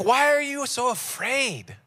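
groggy, slow complaint on being woken; sleepy Jesus. Acted. Sleepy Jesus to the frightened disciples, groggy. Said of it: "He's kinda like sleepy Jesus".

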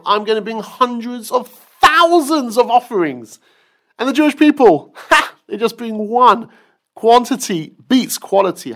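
high, loud, mocking boast with a laugh; boastful Bilam. Bilam boasting to Hashem about his offerings, loud and mocking. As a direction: crow about quantity like a smug braggart. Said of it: "Bilam said to Hashem".

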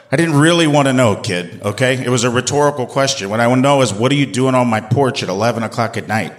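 normal talking voice

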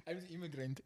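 put-on high, breathy laughing Russian accent line; mock Russian accent. Teasing a friend about his story, mock Russian accent. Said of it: "it was the Russian accent".

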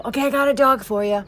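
high, fast eager friend voice; imitating Patty. Patty calling to offer a dog, high and eager. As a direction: pitch it up and rush it like a pushy friend.

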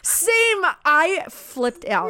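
excited. Real, not acted. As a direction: gush with delight. Excited agreement about a scene.

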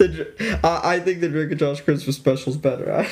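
laughing through the words; giggling, barely keeping a straight face. Real, not acted. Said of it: "You can't even say it with a straight face".

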